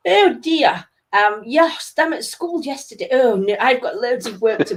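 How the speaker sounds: imitating posh diners, pitch swooping high; mock posh accent. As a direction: put on a hammed-up posh English voice. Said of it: "they was talking like".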